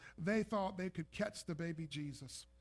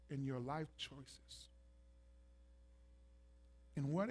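softer, tender call to the heart; quiet pleading appeal; 3 semitones lower, 5 dB quieter than usual. Acted. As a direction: ask them gently and sincerely. Softer, searching appeal to congregation.

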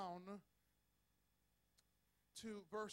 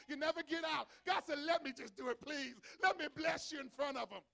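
louder, higher, shouting preacher; full-force preaching; 5 semitones higher, 9 dB louder than usual. Acted. Preacher rousing the congregation, shouting sermon. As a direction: build to a roaring climax.

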